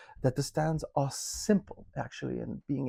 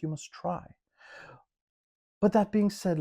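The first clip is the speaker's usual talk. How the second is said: normal talking voice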